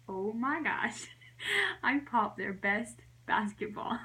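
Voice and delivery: words breaking up with laughter; laughing realisation. Real, not acted. Realising she popped the brothers' basketball, laughing. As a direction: confess it through giggles. Laughing at her own mishap.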